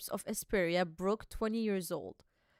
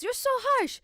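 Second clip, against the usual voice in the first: whiny complaint; 13 semitones higher, 5 dB louder, slower than usual. Acted. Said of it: "He goes".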